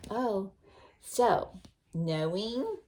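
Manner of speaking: plain reading voice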